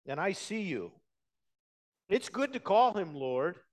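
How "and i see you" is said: emphatic acclaim. Acted. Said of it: "an emphatic way of saying".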